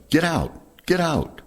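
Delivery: high, urgent retold yell. Gunners yelling to crew after the crash, high urgent shout. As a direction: shout at the crew to escape the burning plane. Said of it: "The two gunners were yelling".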